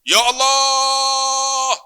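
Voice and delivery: long, high, loud invocation to God; praying aloud. Retold or imitated. Husband praying beside his wife at Arafah, drawn-out high cry. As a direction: draw the call out high and held. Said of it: "saya pun doa".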